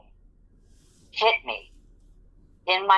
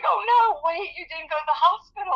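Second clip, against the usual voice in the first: higher, quick worried disbelief; alarmed supervisor; 13 semitones higher, faster than usual. Retold or imitated. Supervisor realizes her worker skipped the hospital, alarmed. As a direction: rush it out, alarmed.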